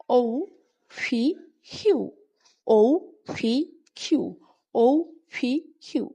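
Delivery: ঔ as "ou", ফি and হিউ with puffed air; pronunciation drill. Shown as a demonstration. Teacher models letters to practise ten times, pronunciation drill. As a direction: repeat ঔ, ফি, হিউ as long separate letters. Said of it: "এভাবে যদি আমরা এক একটাই".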